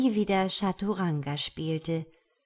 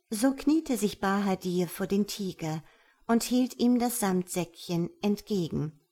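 plain reading voice

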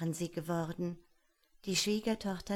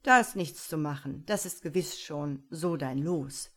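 loud and final; the tsar, resigned; 2 semitones lower, 5 dB louder than usual. Acted. Tsar telling his son to accept the frog, firm. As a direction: close the matter like a king. Tsar settles Iwan's fate.

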